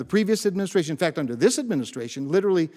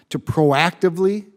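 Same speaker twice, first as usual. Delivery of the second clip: "proactively" punched out louder; stress on proactively; 2 semitones higher, 6 dB louder than usual. Real, not acted. Senator to the committee, loudly stressing "proactively". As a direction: hit "proactively" hard, spelled out for the committee. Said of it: "and I stress proactively".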